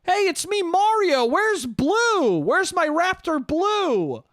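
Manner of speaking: high, drawn-out mock movie-star voice; Chris Pratt as Mario. Actor playing Mario calls for his raptor, high-pitched mocking impression. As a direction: ham it up in a high, stretched-out parody. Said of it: "better than Chris Pratt being like".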